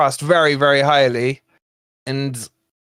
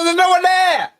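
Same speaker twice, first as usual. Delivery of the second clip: frustrated shout; 13 semitones higher than usual. Real, not acted. Fan yelling at the screen as the cross finds nobody, exasperated shout. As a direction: shout it at the empty back post.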